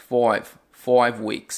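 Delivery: very slow "five" with the vowel sliding toward oi; broad Australian five. Shown as a demonstration. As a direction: stretch "five" like "foive". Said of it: "ali ele fala five weeks". ("five" arrastado, soando como "foive")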